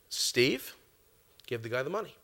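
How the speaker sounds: still small voice. Retold or imitated. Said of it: "still small voice".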